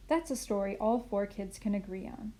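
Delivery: plain reading voice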